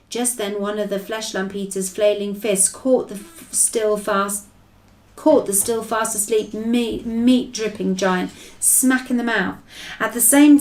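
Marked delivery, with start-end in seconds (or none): none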